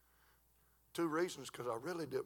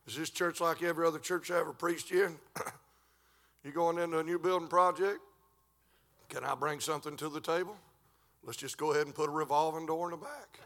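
rising, louder sermon delivery; fiery preaching; 4 semitones higher, 8 dB louder than usual. Acted. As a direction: preach it hard to the church. Preacher building to a shout.

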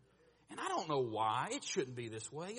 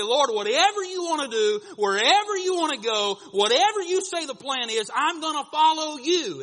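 higher, loud earnest vow; surrender prayer; 12 semitones higher, 16 dB louder than usual. Retold or imitated. Believer surrenders to the Lord, loud and earnest. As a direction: pledge yourself to the Lord with full force. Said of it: "and we say".

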